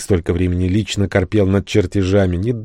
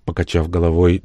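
usual speaking voice

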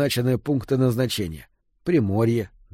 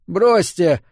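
louder, higher groaned protest; groaned plea; 6 semitones higher, 5 dB louder than usual. A narrator's reading. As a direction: groan it out insistently. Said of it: "застонал Фрид". (громкий протестующий стон)

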